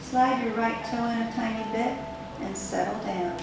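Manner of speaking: usual speaking voice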